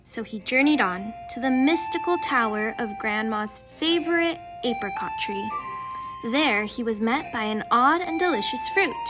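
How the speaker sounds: ordinary delivery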